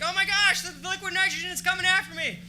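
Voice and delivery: high, loud, hammed-up freak-out; mock panic. Audience member mock-panicking at the fog, hammed-up scared. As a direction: play the spooked onlooker, over the top. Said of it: "They're like".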